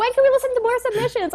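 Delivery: high, eager mom voice. Retold or imitated. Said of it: "she's like".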